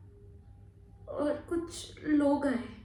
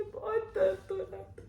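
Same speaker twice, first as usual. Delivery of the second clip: high, thin-volume tearful repeat; subdued crying; 5 semitones higher, 4 dB quieter than usual. Acted. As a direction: sob it out, strength fading. Pain repeated, voice giving out. (धीमे रोते हुए)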